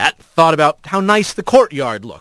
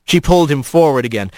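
ordinary delivery, nothing marked

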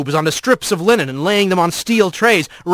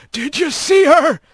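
cracked voice; 8 semitones higher than usual. Acted. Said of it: "a cracked voice called".

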